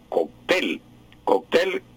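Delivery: "cóctel" said kok-TEL, twice; "cóctel" as an oxytone, TEL stressed; stress on final E. Shown as a demonstration. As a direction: land on the last syllable of "cóctel". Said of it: "con acento en la E final".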